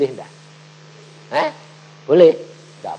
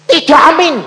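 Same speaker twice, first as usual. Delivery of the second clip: put-on child's blurt; 13 semitones higher, 14 dB louder than usual. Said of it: "Langsung anak bilang".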